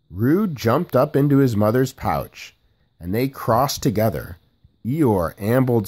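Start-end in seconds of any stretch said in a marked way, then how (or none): none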